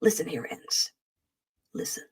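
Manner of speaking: low, hushed mock menace; soft warning with long dramatic pauses; mock threat. Host restarts warning to superchat donor, hushed and mock-solemn.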